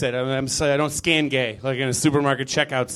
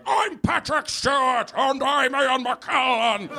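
high, slow, projecting British stage voice; British actors; 9 semitones higher than usual. Retold or imitated. Imitating Patrick Stewart and Ian McKellen, hammy stage. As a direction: boom it like a venerable old thespian. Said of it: "They're like".

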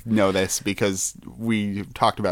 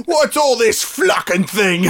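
a hint of Cockney, slow and higher; mock Cockney; 8 semitones higher than usual. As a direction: play up a jokey Cockney swear. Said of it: "Sounds like Cockney swearing".

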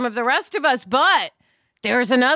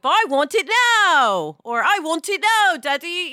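put-on high, loud childish whine; whiny child; 8 semitones higher, 4 dB louder than usual. Imitating a spoiled child demanding it now from daddy. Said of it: "that juvenile place of".

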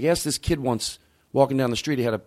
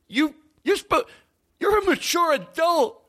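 mocking lecture.

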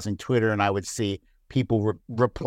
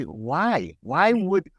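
normal talking voice

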